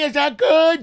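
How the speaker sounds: high loud mock question; mock aunt voice. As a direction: parody the bad actress. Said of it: "Aunt Tammy".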